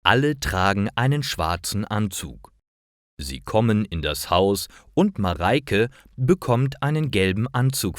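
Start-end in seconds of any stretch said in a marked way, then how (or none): none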